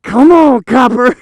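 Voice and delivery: loud, high-pitched mock challenge; taunting shout. Mother challenging the officer, hammed-up taunting shout. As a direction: goad the cop at full volume.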